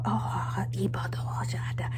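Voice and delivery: soft, slow, whispery imitation; hushed admiration. Imitating landlord admiring her in Korean, hushed admiration. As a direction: draw it out in an awed near-whisper.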